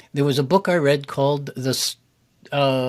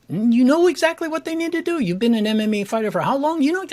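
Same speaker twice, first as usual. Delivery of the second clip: higher, fast impatient coach voice; mock blunt coach; 10 semitones higher than usual. Coach lecturing an MMA fighter, higher and fast. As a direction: rattle it off like a pushy coach.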